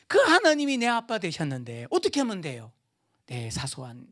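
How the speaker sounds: ordinary delivery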